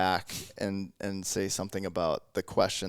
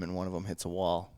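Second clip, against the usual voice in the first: normal talking voice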